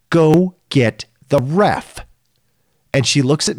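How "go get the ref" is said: slow, firm, raised command; firm retold order. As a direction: lay down the order slowly. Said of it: "I go".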